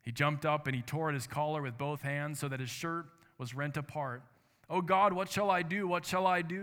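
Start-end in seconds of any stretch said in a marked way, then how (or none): 4.7-6.6 anguished cry, higher and louder; cried out. A narrator's reading. Soldier crying out in guilt, anguished and loud. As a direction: cry it out in despair to God. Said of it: "he cried".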